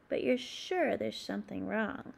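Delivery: plain reading voice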